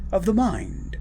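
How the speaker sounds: ordinary talk, nothing marked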